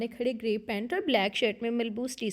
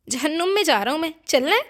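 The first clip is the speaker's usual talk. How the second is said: higher, louder, fast snapping reply; angry retort; 5 semitones higher, 8 dB louder, faster than usual. A narrator's reading. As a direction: bite back at the pestering brother. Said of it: "वो गुस्से में बोला". (गुस्से में तेज़ तीखा जवाब)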